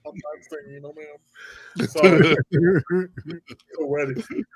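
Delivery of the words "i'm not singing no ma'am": timid reply. Retold or imitated. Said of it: "I was like".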